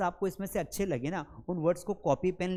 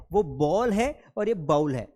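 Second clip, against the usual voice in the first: बॉल and bowl set apart slowly and loudly; careful contrast; 5 dB louder than usual. Shown as a demonstration. Teacher contrasts the two words for the students: slow and clear. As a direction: say बॉल then bowl slowly, marking the difference. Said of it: "बस थोड़ा सा अंतर है".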